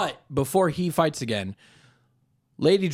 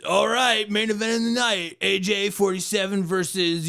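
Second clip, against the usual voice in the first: higher hyped announcer call; mock announcer; 6 semitones higher than usual. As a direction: hype the crowd. Arena announcer introduces fight.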